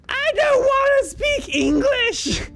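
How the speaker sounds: put-on high, loud, drawn-out child's yell; kid's loudest shout. Kid yelling at the room that she refuses English. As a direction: belt it like a defiant little kid. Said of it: "just in the loudest voice ever".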